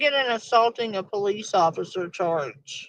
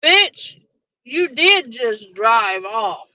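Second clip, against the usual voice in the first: loud, slow, emphatic rebuttal; angry retort; 5 dB louder, slower than usual. Real, not acted. Yelling back at the driver's excuse, slow and loud. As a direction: call out the lie, hammering each word.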